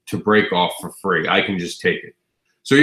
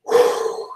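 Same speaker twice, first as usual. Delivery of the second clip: breathy whew through back pain; deep breath out. Real, not acted. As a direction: blow out a long breath. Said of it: "deep breath".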